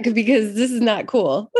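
laughing through the words; chuckling while saying it. Real, not acted. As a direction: let a real laugh spill into it. Laughing over shared joke.

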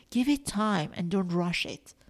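ordinary talk

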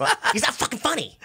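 very high mock yelling; imitated Rogan. Rogan complaining to Mitzi about the comic, high strained mock yell. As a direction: squeal it like a hammed-up heckler.

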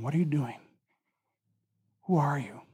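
low, hushed questions; soft, low murmur to one person's heart; gentle whisper. Acted. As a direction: ask very softly, with space between. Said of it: "He whispers to him".

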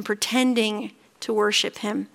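ordinary talk

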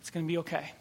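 quiet calming words to a scared woman; calm reassurance. Retold or imitated. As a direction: soothe a frightened stranger gently. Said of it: "looks at her calmly and says".

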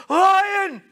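sudden high, loud cry of alarm; panicked yell. Pretend. Warthog spotting a lion, a panicked high shout. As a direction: shout it like you've just spotted the predator. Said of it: "looks up and goes".

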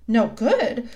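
alarmed, jumping up; rather frightened. A narrator's reading. Lucy to the faun as she jumps up, frightened. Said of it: "feeling rather frightened".

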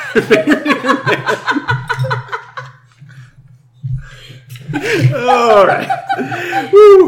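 breaking into high laughter mid-name; laughing so hard the words crack. Real, not acted. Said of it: "My stomach hurts".

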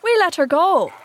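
high, loud, pleased-with-himself sidekick; complacent. A narrator's reading. Smee reports to his captain, high and complacent. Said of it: "Smee answered complacently".

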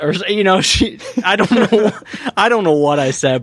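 giggling through words; laughing. Real, not acted. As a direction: laugh as you talk. Laughing while retelling.

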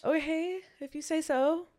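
high, sceptical mock agreement; doubtful acceptance. Retold doubtful reply to the advice.

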